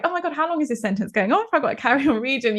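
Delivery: rushed, mock breathless read of a long sentence; fast breathless reading. Author reading her own book aloud, rushed and breathless. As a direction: race on without pausing for breath. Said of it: "because I was like".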